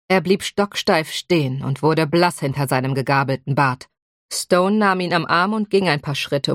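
ordinary delivery, nothing marked

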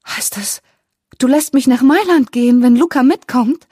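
hushed disbelief bursting into a high, loud question; astonished. A narrator's reading. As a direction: start under your breath, then burst out in amazed delight. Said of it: "sah ihren Vater erstaunt an".

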